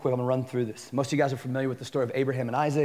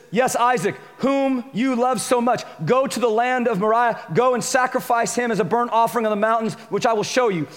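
raised preaching read; 5 semitones higher than usual. Acted. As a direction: proclaim the command.

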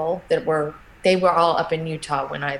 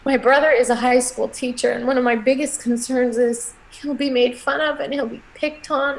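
holding back tears while telling her worry; emotional voice; 6 semitones higher than usual. Real, not acted. Tearful mother telling the interviewer about her fears for her son. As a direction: voice breaking, pitch climbing higher as tears come. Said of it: "sorry, I still get emotional".

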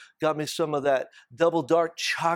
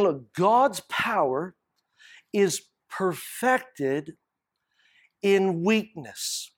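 each word set apart, very slow and weighty; slow emphasis; much slower than usual. Real, not acted. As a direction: let the verse land, word by word. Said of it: "preacher slows to stress verse".

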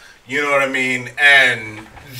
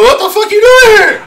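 mock outraged shriek; 5 dB louder than usual. A man greeting his early friend in a high shriek. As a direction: pitch shoots way up in outrage. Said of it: "I go".

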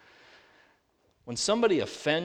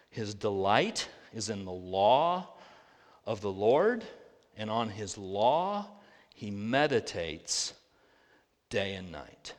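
scripture reading; 5 semitones lower, slower than usual. Acted.

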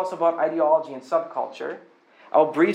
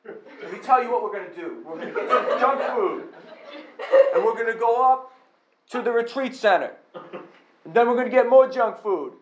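higher, hammed-up macho pitch; mock men's retreat leader; 5 semitones higher than usual. Preacher mimics men's event leader rallying men, mock macho. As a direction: sell junk food and guns like a bro leader.

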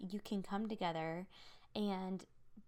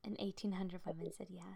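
whispering; 6 dB quieter than usual. Real, not acted. Echoing the number in awe.

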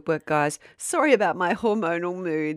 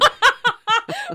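high laugh; 6 dB louder than usual. Real, not acted. Bursts out laughing at the roast.

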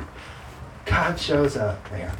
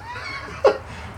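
high laugh; 6 dB quieter than usual. Real, not acted. Laughing at his own joke.

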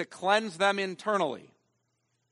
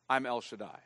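I'm El Shaddai said softer and lower, drawn in close; hushed divine reply; 6 semitones lower, 7 dB quieter than usual. Acted. Preacher voicing God's answer to weary Abraham, quiet and low.

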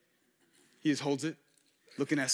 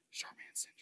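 hushed whisper; whispered aside; 23 dB quieter than usual. Real, not acted. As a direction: whisper it like something you shouldn't say. Hushed aside naming a cheeky term.

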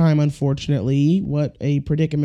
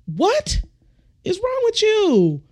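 very high falsetto incredulity; hammed-up outraged bewilderment; acted shocked disbelief; slower than usual. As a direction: squeal it like a stunned driver, without volume. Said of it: "And you're like".

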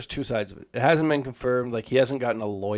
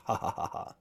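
breathy, quiet mock villain laugh; little villain laugh; 10 dB quieter than usual. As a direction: read out a tiny evil laugh. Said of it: "Little villain laugh at the end".

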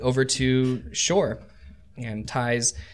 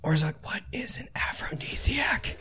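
confidential whispered question; 8 semitones higher, 4 dB quieter than usual. Acted. Davery whispers to Orzak while horses are offloaded, whispering. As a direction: ask it in a hushed, secretive whisper. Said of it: "and he whispers".

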